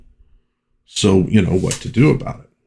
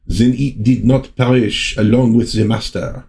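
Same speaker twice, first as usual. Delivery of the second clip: put-on French accent, slightly raised; 3 semitones higher than usual. Reading Aurora's line from the comic in a French accent. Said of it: "much more French accent for Aurora".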